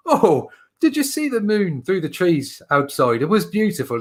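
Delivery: put-on an Irish lad marvelling at the moon in the Irish accent; a hint of a wistful Irish brogue. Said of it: "He says".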